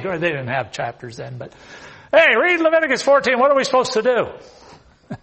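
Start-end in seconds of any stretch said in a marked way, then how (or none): 2.1-5.2 mock frantic call. Acted frantic priest calling scribes.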